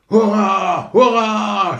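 high, loud cheer; cheering hurrah. Acted. Courtiers cheer the fireworks, high loud cheering. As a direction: cheer like a delighted crowd. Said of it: "schrien alle Hofleute".

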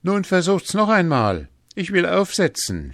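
loud, high-pitched challenge; bold challenge. A narrator's reading. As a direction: call out boldly to the other players. Hans challenges the bowlers.

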